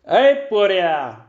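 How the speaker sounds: drawn-out street call; sudden hail. A narrator's reading. Magician to the boy under the sheet, calling out. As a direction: hail him suddenly, stretching it out. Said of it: "मग एकदम हाक मारली".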